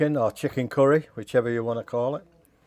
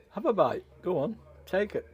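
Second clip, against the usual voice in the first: higher, sing-song coaxing to the food; playful coaxing; 7 semitones higher than usual. Pretend. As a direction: coax it like a pet, pitched up. Coaxing food onto the fork.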